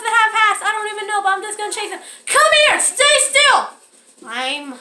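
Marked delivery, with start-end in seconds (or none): 2.2-3.7 high, urgent call. Acted. Player chasing frogs in hats, high urgent call. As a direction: order a runaway to stop.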